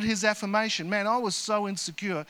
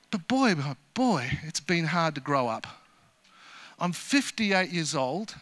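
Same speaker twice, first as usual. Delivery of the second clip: ordinary delivery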